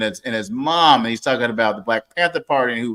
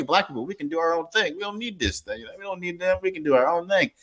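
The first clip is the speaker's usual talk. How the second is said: higher, softer, intense preaching tone; 4 semitones higher, 7 dB quieter than usual. Retold or imitated. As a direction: lay out the call like a hushed rally speech. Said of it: "I never heard anybody say".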